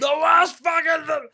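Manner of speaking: slow, loud, high exasperated yell; drawn-out shout. Real, not acted. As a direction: stretch it out in a shout. Exasperated outburst about movie.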